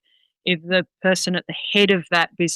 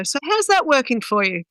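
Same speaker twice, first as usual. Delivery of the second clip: mock polite; 6 semitones higher than usual. Trainer answers a dog owner's story, acted politeness. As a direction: politely put question in a higher pitch. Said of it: "you say it politely".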